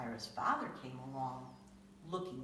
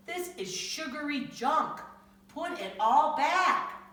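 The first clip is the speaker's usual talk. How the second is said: loud, slow, stern telling-off rising higher; scolding father; 10 semitones higher, 8 dB louder, slower than usual. Acted. Father scolding Tyra about junk food, loud stern slow scold. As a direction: lay down the law to a kid.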